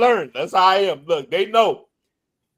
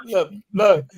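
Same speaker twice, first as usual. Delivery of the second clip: ordinary talk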